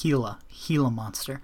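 Gila said HEE-la, G as an H; Gila with silent G. Shown as a demonstration. Correcting a friend on Gila, pronounced HEE-la. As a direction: start Gila on a breathy H sound, "HEE-la". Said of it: "it's pronounced Gila".

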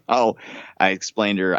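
ordinary delivery

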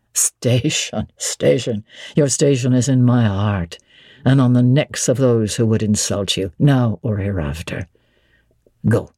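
low, assured nobleman addressing Jane; Rochester. Acted. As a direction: speak as the commanding master of the house. Rochester voiced low by reader.

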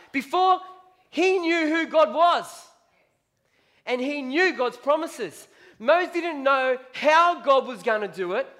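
building sermon climax. Real, not acted. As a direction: preach it up, pressing harder each line.

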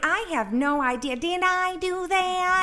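high nasal-free squeaky Urkel voice, slowing at the end; mock innocent Urkel; Urkel impression. Mocking Fauci's denial in an Urkel voice. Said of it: "Pulling an Urkel".

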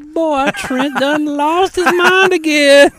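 squeaky slowed-down caricature of a friend; mock exasperated friend. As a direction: ham up an old-timer shaking his head at Trent.

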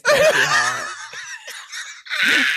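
high loud burst; laughing shout. Real, not acted. Reading the listener's line, bursting out in a high loud laugh.

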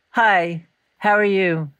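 subdued phone hello, with a pause after "Hi"; unhappy greeting. Retold or imitated. Imitating mother answering the phone unhappy, subdued and halting. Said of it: "I knew she was unhappy".

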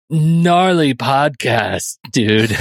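slow stretched surfer-valley drawl; hammed-up Valley Girl slang delivery; Valley Girl speak. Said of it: "I'm gonna stop that".

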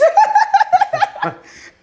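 loud, high burst of laughter. Real, not acted. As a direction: cackle at the tease. Teasing date about being toxic.

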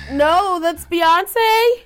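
high, loud and drawn out in disbelief; stunned she got it wrong; surprised. Real, not acted. Shocked at wrong answer.

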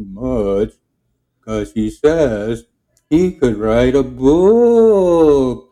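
very slow, drawn-out character voice; Fernando's slow talk. Retold or imitated. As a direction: stretch every word as if there's all day. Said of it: "and then Fernando".

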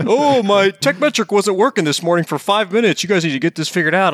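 mock the drama queen customer with a laugh; mocking whiny customer. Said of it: "They act like the world's coming to an end".

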